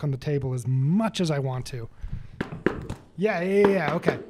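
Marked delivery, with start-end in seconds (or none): none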